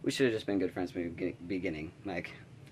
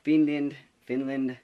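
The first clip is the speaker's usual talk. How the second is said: slow, raised mock Finnish accent; a hint of Finnish accent, higher and careful; imitated Finnish accent; 4 semitones higher, 6 dB louder, much slower than usual. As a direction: lightly imitate a Finnish girl's accented English, louder and drawn out. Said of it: "she has this beautiful accent".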